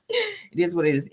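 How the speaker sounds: high, giggling shrug; laughing. Real, not acted. Host giggling after throwing shade at Common.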